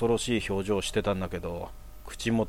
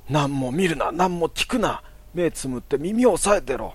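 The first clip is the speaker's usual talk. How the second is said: higher, louder urgent orders; urgent commands; 8 semitones higher, 5 dB louder than usual. Retold or imitated. Mother orders her son to close his eyes and ears, urgently. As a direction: command him sharply to shut everything out.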